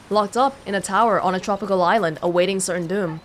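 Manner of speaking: ordinary delivery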